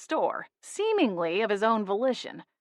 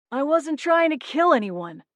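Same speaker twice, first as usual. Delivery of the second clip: protesting; 7 semitones higher than usual. A narrator's reading. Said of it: "Buckley protested".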